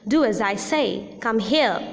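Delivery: commands said with a falling tone; firm falling end on "here". Shown as a demonstration. Teacher demonstrating falling commands. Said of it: "Falling tone is also used in com-, used in commands".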